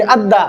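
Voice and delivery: अद्दा with a light, thin D; आराम से. Shown as a demonstration. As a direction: say अद्दा gently, unrounded. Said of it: "बिल्कुल महीन आवाज करेंगे". (अद्दा को आराम से, महीन)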